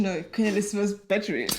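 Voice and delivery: normal talking voice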